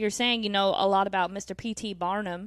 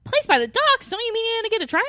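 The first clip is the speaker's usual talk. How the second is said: much higher, louder mock question; 13 semitones higher, 7 dB louder, faster than usual. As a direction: squeal it in disbelief. Mock reply to the movie.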